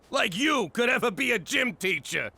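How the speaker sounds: laughing scornfully through the words; mocking laughter. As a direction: laugh in his face. Mocking son's dream, laughing.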